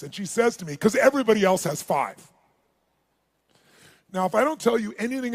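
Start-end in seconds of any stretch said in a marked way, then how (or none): 0.8-2.3 defiant retort. Retold or imitated. Girl explains earrings defiantly.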